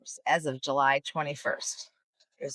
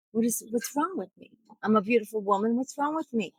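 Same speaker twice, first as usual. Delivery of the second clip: higher-pitched pleading patient; acted plea; 4 semitones higher than usual. Woman asks the doctor what's wrong with her, pleading. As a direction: plead in a raised, puzzled voice.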